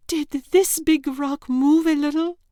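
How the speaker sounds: put-on girl in a cave demanding to know, loud and high. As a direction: insist on an answer, alarmed. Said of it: "Yelda demanded".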